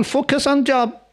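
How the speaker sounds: high, urgent sermon voice; preaching at full force. Acted. Preacher warns the listeners about troublemakers, urgent and high. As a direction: press the warning home to the crowd.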